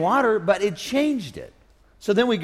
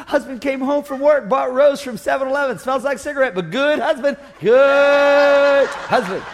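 louder, stretched-out doting praise; mock praise of husband; 5 semitones higher, 4 dB louder than usual. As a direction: gush over him like a good dog.

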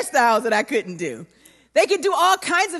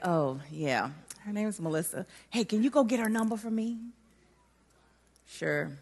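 much softer, heart sunk; deflated; 5 semitones lower, 11 dB quieter than usual. Retold or imitated. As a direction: hide the disappointment, answer quietly. Crushed girl answering the boy.

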